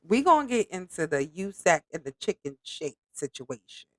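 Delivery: ordinary talk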